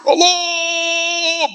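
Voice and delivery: one long, high hammed-up cry; mock wailing. Preacher retells crying out in prayer, mock wailing. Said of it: "Kita teriak".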